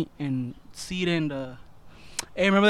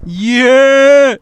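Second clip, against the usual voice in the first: loud shout; 8 semitones higher, 17 dB louder than usual. Real, not acted. Hyped agreement shout.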